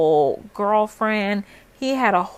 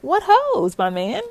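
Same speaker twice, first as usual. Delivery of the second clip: much higher, disbelieving repeat; incredulous retort; 7 semitones higher than usual. Retold or imitated. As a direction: throw his words back at him in disbelief. Mocking his excuse, incredulous.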